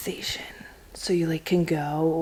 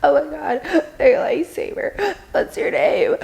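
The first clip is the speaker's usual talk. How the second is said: put-on high, louder gushing tipsy girl; drunk girl; 9 semitones higher, 3 dB louder than usual. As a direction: gush like a tipsy stranger. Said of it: "she's wasted, she's, like, hiccuping".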